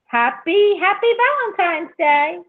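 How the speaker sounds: high, loud, celebratory send-off; big, high-pitched holiday wish; cheery holiday greeting. Real, not acted. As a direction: call it out high and festive. Cheery holiday farewell to caller.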